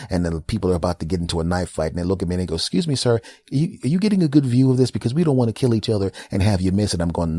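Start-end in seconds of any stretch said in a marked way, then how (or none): 2.5-7.0 high, fast, faux-polite tough guy; comic high mock courtesy; mock polite fighters. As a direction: sweetly ask if he's enjoying the view. Repairmen voiced mid knife fight.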